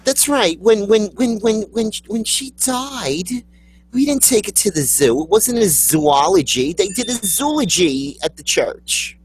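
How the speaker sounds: high-pitched churchgoer recounting a funeral; mock funeral guest, pitched high; gushing funeral mourner. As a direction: stammer indignantly in a high put-on voice. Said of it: "in front of the church".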